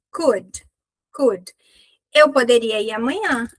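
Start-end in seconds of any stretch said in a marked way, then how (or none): 0.1-1.5 "Could" short and dry, stopped d; crisp dry "Could". Shown as a demonstration. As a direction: clip "Could" crisp, no vowel after d. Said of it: "esse could é sequinho".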